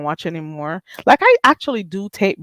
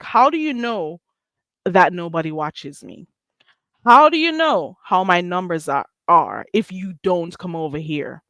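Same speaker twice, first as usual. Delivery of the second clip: usual speaking voice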